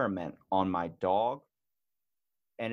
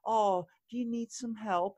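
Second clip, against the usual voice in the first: put-on high, babying voice; mock patronizing, much higher pitch; talking down to someone; 10 semitones higher than usual. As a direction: coo it as if to a helpless baby. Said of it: "they talk to me in, like, this".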